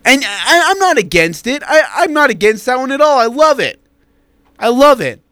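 high, animated insistence; excited protest. Real, not acted. Arguing his point on air.

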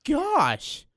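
shocked. Real, not acted. Reacts to crushed bone story.

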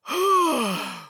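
heavy voiced ogre sigh; loud exhaled "ah"; long sigh. A narrator's reading. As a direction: heave a big weary sigh. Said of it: "The ogre sighed a long, long sigh".

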